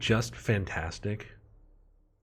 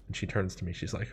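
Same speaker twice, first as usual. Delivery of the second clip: plain reading voice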